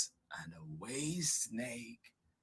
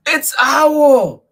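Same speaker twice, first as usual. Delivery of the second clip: high, loud squeaky announcement; piercing little mouse voice; excited mouse; 18 dB louder than usual. Acted. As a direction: squeal out who it is. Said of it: "said the mouse".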